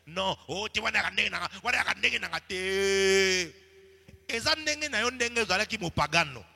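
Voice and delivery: loud, high preaching voice in French and Lingala; fiery sermon delivery; full-force preaching. Acted. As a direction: drive the rebuke home to the congregation.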